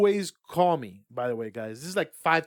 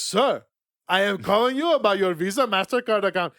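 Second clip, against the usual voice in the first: mock Indian call-centre accent, raised and louder; 2 semitones higher, 5 dB louder than usual. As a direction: imitate the Indian scammer's accent, polite "Sir". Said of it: "I don't wanna do the accent".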